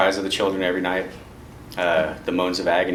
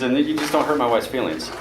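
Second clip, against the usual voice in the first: ordinary talk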